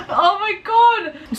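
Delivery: high, drawn-out gasped outcry; gasp, then wail it in disbelief; overwhelmed, stretched-out exclamation; exasperated exclamation. Real, not acted. Exclaims about sweating in class.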